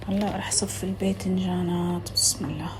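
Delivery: hushed whisper to herself. Real, not acted. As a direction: whisper it under the breath. Whispered aside while cooking. (همس خافت)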